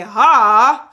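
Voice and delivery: one sharp, loud chest-voice "Ha!". Shown as a demonstration. Teacher shows students how chest voice feels. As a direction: punch a "Ha!" from the chest. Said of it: "and you say".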